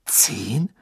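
hushed questioning "Zehn?" that rises at the end; understated incredulous question; disbelieving. A narrator's reading. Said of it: "sagte Harry ungläubig".

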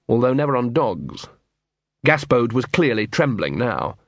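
ordinary delivery, nothing marked

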